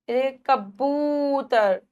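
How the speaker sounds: कबूतर with the ऊ held slow; drawn-out oo. Shown as a demonstration. Teacher drawing out कबूतर to show long ऊ. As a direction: stretch कबूतर out slowly. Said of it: "खिचड़ी है बच्चों".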